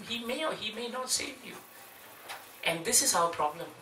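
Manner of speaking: normal talking voice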